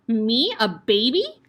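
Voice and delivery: a slow, loud, disbelieving roar; outraged shout. Acted. Headmistress shouts at the boy who called her a baby. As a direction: bellow it, offended. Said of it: "shouted the Trunchbull".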